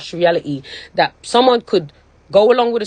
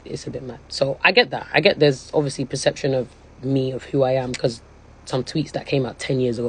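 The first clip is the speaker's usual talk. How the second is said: quiet, low, tearful voice barely holding together; real crying; 6 semitones lower, 6 dB quieter than usual. Real, not acted. Explaining old tweets to viewers, quietly crying. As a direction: speak through tears, words sinking low. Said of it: "I came online and I was fucking crying".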